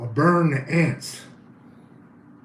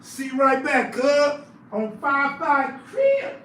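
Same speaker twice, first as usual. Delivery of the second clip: put-on high voice; 8 semitones higher, slower than usual.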